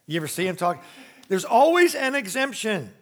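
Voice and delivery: ordinary talk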